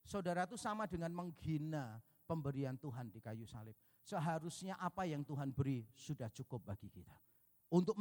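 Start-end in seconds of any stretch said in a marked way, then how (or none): none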